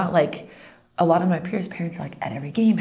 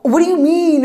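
much higher, louder, baffled; surprised parent; 12 semitones higher, 7 dB louder, slower than usual. Retold or imitated. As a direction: react with genuine confusion. Puzzled parents answer.

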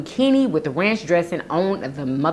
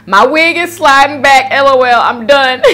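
laughing; 6 semitones higher, 7 dB louder than usual. Real, not acted. Reading funny comment, laughing.

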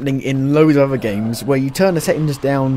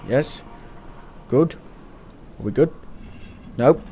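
ordinary talk, nothing marked

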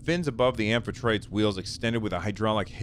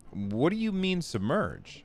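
cried out; 7 semitones higher than usual. A narrator's reading. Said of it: "I cried".

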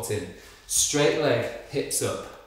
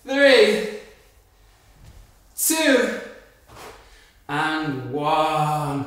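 building count; 3 semitones higher, 5 dB louder, much slower than usual. Real, not acted. Coach counting down rising.

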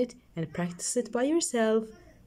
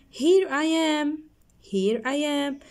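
bright read-aloud; 6 semitones higher, 3 dB louder, slower than usual. Acted. Quoting the lesson line brightly.

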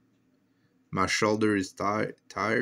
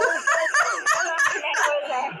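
high, startled shriek of laughter; real scared laugh that bursts out high. Real, not acted. Radio voice shrieks suddenly.

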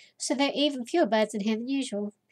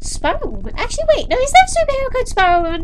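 much higher, fast, loud amused question; excited realisation; 12 semitones higher, 7 dB louder, faster than usual. Real, not acted. Player realising the name sounds like a superhero, higher and quick. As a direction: burst out as the joke dawns on you.

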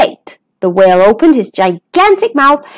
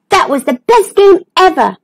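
higher, thrilled exclamation; laughing delight; 7 semitones higher than usual. Acted. Dennis after the whale's sneeze, thrilled delight. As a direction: say it high with delight. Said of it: "laughed Dennis".

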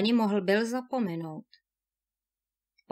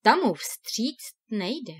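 usual speaking voice

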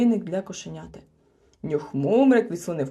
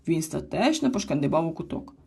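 usual speaking voice

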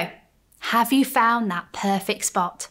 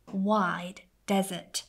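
ordinary delivery

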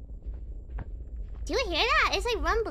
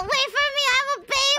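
put-on baby voice; 10 semitones higher, 4 dB louder, much faster than usual. Playing a baby character.

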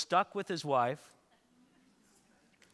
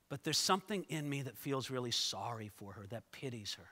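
tender pity; 6 semitones lower, 8 dB quieter than usual. Real, not acted. Pity for Potiphar's wife.

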